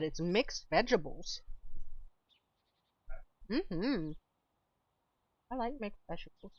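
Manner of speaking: talking while eating; words round a bite of food; mouth full. Real, not acted. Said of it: "if y'all can hear me eating".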